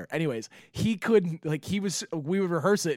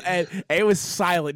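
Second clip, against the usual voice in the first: usual speaking voice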